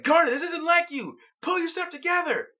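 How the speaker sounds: high, pleading urgency; urgent plea. Retold or imitated. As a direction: beg her to snap out of it. Steven pleads with Garnet.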